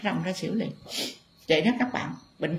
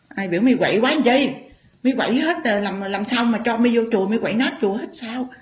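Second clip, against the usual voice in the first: scolding the spirit; 5 semitones higher, faster than usual. Acted.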